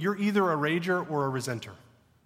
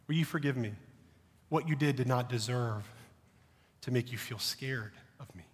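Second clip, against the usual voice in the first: put-on soft, low confession to a frightened child; father quietly apologizing to his scared son, tender; tender apology; 5 semitones lower, 6 dB quieter than usual. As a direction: gently ask a small son's forgiveness.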